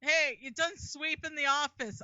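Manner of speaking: casually rope the janitor into voice work; offhand studio boss. Acted. Acting out a casual dubbing hire.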